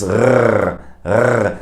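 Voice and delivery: rrr rasped at the back of the throat; rrr, uvular scrape; German throat R. Shown as a demonstration. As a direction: growl rrr in the throat, slowly. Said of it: "comes from the back of the throat".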